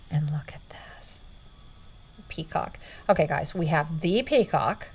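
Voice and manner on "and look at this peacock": soft whispered reveal; reverent whisper. Real, not acted. Showing viewers a peacock ornament, whispering. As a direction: unveil it in a hush.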